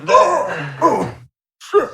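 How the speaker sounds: acted pained reaction. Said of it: "he goes".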